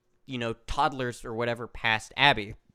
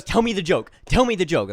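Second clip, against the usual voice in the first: put-on louder, repeated, insistent kid quote; demanding child; 4 semitones higher, 7 dB louder than usual. Child demands the joke from the storyteller, loud imitation. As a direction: hammer out the demand like an impatient child. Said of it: "'Cause she goes".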